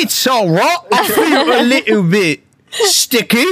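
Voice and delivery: high-pitched hammed-up British accent. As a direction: play it very British, high and drawn out. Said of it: "have to be in British accent".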